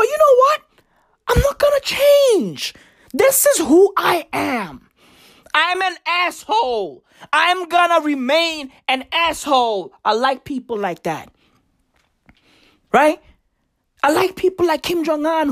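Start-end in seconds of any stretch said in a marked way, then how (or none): 0.0-9.9 high, proud voicing of someone who won't change; defiant self-declared asshole. Comic declares proudly for stubborn people: high defiant boast. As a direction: play the unapologetic jerk announcing himself.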